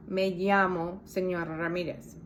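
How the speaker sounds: llamo said "yamo"; Mexican ll. Shown as a demonstration. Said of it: "The two L's makes the Y sound".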